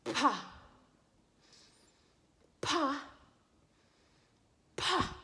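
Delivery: each "Pa" a separate airy pop of the lips; crisp puff of air on every "Pa"; P sound demo. Shown as a demonstration. Teacher going first before the child tries, P sound demo. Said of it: "I'll go first".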